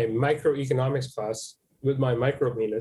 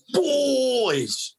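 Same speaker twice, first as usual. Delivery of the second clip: squeaky high comic voice; 9 semitones higher than usual. Acted. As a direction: squeak the word out.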